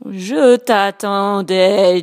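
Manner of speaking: solemn octopus greeting, drawn out; grave octopus voice. Acted. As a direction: speak slowly like a wise sea creature. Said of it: "dit Octopus d'une voix grave".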